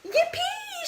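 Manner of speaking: high gleeful yell; boy thrilled to find another monster; excited shout. Acted. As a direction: squeal with delight. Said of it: "shouted George".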